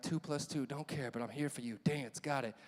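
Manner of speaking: quiet, low distracted mumble; low inner mumble. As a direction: mutter it to yourself in class. Inner voice in class.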